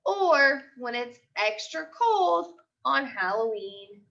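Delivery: put-on lively storybook voice for young kids; picture book read-aloud. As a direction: read it like a class story time. Teacher reads picture book aloud.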